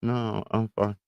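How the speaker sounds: usual speaking voice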